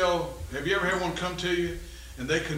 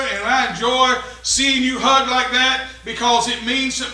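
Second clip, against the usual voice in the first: louder, higher-pitched preacher's delivery; impassioned preaching; 6 semitones higher, 8 dB louder than usual. Real, not acted. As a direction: preach it out with raised voice and full conviction. Preaches at full force.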